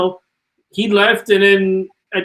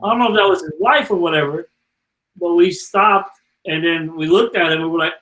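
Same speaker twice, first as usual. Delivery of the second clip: usual speaking voice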